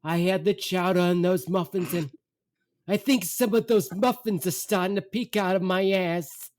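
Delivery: high, mock-pained mom voice; distressed mother. Mother teetering on a bench to son, distressed. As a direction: imitate a mother confessing her stomach trouble. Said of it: "She says".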